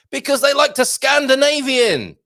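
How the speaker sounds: spring the pun loud and high, pleased with it; punchline. Acted. Streamer springs the pun punchline on chat, high and loud.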